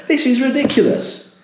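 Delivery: high incredulous exclaim on ridiculous; mock outrage. Shown as a demonstration. Presenter reacting to the guy with stales, amused disbelief. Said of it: "you go".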